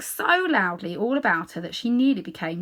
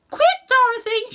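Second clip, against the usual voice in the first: much higher, loud frightened scream; 8 dB louder than usual. Acted. Aunt Em screams at Dorothy to flee, frightened. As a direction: scream at the child to hurry. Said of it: "she screamed".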